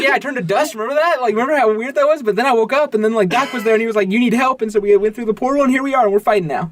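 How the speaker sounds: lightly high Spider-Man voice; bouncy, eager, high; high excited kid voice. Retold or imitated.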